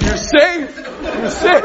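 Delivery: high, loud, laughing cheer; half-laughing "you're safe" call; mock cheer. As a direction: cheer yourself on like a coach, laughing. Cheering himself on at mirror.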